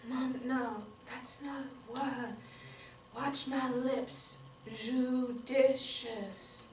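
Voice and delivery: slow, careful enunciation for lip-reading; patient pronunciation coaching. Acted. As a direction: spell it out gently for mom, very slowly. Child coaching deaf mother's pronunciation.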